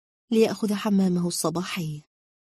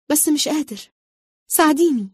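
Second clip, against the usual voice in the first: high-pitched plea, growing louder; acted desperate appeal, pitched up; pleading; 7 semitones higher, 5 dB louder than usual. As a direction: beg for help, helpless. Character pleads for help.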